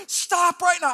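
high, urgent command; freaking out. Retold or imitated. Panicking passenger to the pastor driving, freaking out. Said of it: "I, like, freak out".

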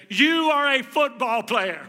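loud, slow declaration; slow emphatic shout. Retold or imitated. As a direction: proclaim it slowly and loudly. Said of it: "screamed at him".

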